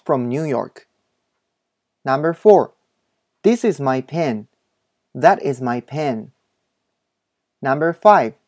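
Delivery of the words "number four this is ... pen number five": slow, carefully spaced model English reading; お手本の英文音読. Shown as a demonstration. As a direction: read each sentence slowly for learners to repeat. (英語のお手本をゆっくり音読)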